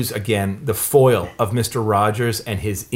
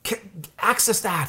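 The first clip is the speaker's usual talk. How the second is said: high, soft urging voice; lightly acted gentle therapist; Mister Rogers; 8 semitones higher, 6 dB quieter than usual. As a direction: coax someone toward their anger, high and hushed. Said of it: "Mister Rogers is like".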